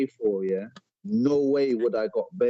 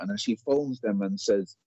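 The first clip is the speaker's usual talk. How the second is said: ordinary delivery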